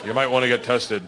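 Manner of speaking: low, deadpan Minnesotan producer; understated, matter-of-fact mock deadpan; dry. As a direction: deliver bad news drily, unfazed. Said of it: "Real dry".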